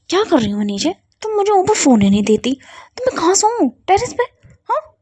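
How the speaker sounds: high, loud indignant retort; angry outburst. Acted. Siya on the floor fires back at Ruhi, indignant and loud. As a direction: fire it back, fed up.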